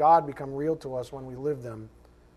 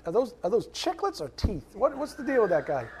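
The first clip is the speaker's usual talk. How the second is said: a hint of the congregation's baffled scoff, laughing through it; mock disbelief with a laugh; 6 semitones higher than usual. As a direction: poke fun at how they react to him, mid-laugh. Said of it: "and you guys go".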